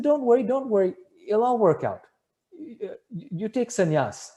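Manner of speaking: high, easy dismissal slowing at the end; imitated reassurance. Guru pressing devotee to take sannyas, insistent reassurance. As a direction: voice a guru brushing objections aside.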